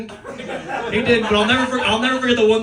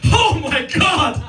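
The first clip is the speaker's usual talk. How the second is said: mock panic; 11 semitones higher, slower than usual. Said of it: "You're like".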